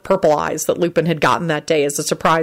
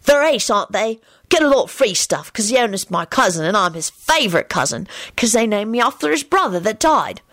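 lightly voiced boy, higher pitched; chatty boastful boy; 4 semitones higher than usual. Boy tells Timothy about his cousin, boastful. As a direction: chat proudly like a young boy.

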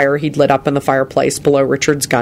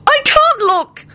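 slow, very high wail; sobbing; much slower than usual. A narrator's reading. Elizabeth repeats it in distress, sobbing slowly. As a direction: drag the words out in a high sob. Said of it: "she sobbed".

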